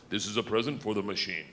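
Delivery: low, soft, solemn Russian conductor; Russian accent. Retold or imitated. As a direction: offer tribute gravely in a low Russian accent. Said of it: "places them on my lap and goes".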